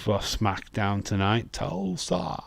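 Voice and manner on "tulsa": Tulsa said higher in a put-on American twang; mock American Tulsa. Shown as a demonstration. Host playing with his accent for the co-host, mock American. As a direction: drawl Tulsa like a local. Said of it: "my accent just goes all over the place".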